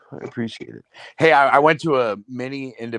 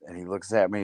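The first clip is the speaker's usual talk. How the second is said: usual speaking voice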